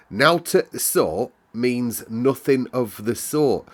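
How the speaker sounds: slow, careful, spelling out each word; slowly and carefully. Acted. Martha translates her Yorkshire for Mary, slow and careful. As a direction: explain it slowly so the child follows. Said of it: "slowly and carefully".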